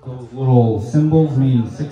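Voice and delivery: ordinary talk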